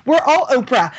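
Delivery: high, loud hammed-up cheer; mock Oprah giveaway. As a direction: announce like a TV giveaway. Mock grand generosity.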